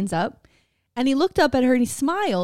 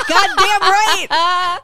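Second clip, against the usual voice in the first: slow, drawn-out mock proud shout, pitched higher; mock proud yell; 8 semitones higher, 9 dB louder, much slower than usual. To her friend about her Ireland welcome, slow proud shout. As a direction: bellow it like a triumphant celebrity. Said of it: "I'm like".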